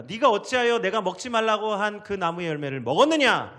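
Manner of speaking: preacher lightly voicing a stern God; God demanding an account. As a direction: call Adam to account, loudly. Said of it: "아담에게 먼저 책임을 묻습니다".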